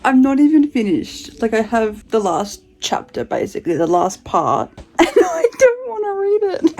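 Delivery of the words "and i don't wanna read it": high, tearful half-laugh; dreading the ending and crying a little; laughing through tears. Real, not acted. Vlogger dreading the last chapter, tearful laugh.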